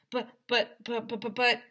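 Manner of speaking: stammering protests; mock flustered stammer; sputtering buts. A narrator's reading. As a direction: sputter helplessly.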